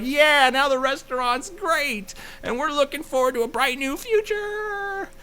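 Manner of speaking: hammed-up chipper happy ending; mock cheesy optimism. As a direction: parody a saccharine reality show ending.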